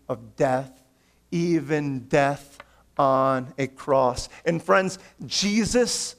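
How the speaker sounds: impassioned preaching. Acted. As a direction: preach it with rising conviction. Climactic preaching about Jesus.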